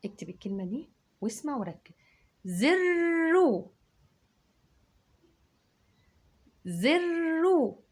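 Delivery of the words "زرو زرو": زرو drawn out slowly, high and loud; doubled r. Shown as a demonstration. Teacher sounding out a shadda for kids. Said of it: "الراء شدتها".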